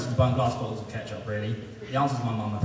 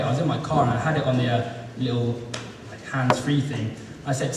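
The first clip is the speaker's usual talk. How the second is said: usual speaking voice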